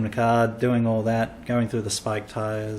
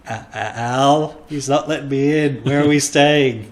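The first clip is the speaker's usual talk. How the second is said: panicked call; 2 semitones higher, 6 dB louder than usual. Retold or imitated.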